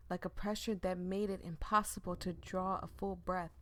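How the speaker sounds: ordinary talk, nothing marked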